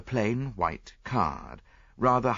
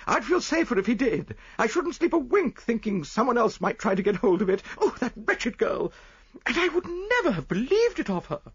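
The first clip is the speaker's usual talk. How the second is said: very high, fretful wife's voice; crying wife; 4 dB louder than usual. A narrator's reading. Wife upset over the wretched girl, high anxious cry. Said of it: "cried his wife".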